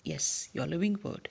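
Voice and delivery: ordinary delivery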